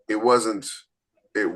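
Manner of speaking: normal talking voice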